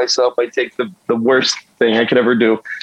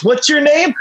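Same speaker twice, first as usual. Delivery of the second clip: high stranger voice; 12 semitones higher than usual. Retold or imitated. Tearful stranger asks his name.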